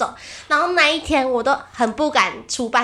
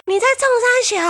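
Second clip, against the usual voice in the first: mock angry stranger; 10 semitones higher, much faster than usual. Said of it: "眼睛睁大这样看我".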